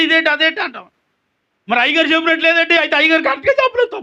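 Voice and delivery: high, loud, sustained preacher's voice; impassioned preaching. Acted. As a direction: protest loudly as a preacher acting out complaints. Preacher in raised declaiming voice.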